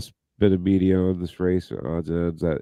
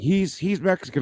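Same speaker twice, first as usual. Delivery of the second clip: mocking critics; 11 semitones higher than usual. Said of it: "because they're like".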